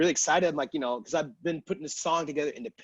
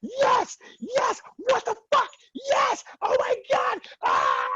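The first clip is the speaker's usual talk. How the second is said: much higher, loud ecstatic screaming; freaking out; 6 dB louder, much slower than usual. Retold or imitated. Organizer runs up screaming with joy. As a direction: scream with delight. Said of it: "She starts freaking out".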